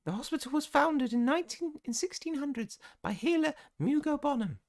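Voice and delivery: very high, fluting matron voice; gushing healer supervisor. Acted. Madam Strout welcoming students to the hospital, very high gushing voice. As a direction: coo at your little lambs in falsetto.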